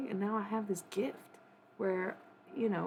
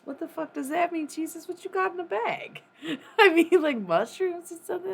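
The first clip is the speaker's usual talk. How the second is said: incredulous outburst; 12 semitones higher, 10 dB louder than usual. Real, not acted. Incredulous rant at Jesus.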